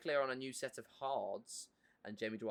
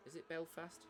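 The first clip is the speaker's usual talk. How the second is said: Belfast in a basic English accent, higher and softer; 6 semitones higher, 7 dB quieter than usual. Shown as a demonstration. Streamer testing how to say Belfast, English accent. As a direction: try Belfast the English way, tentatively. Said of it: "In, like, an English accent".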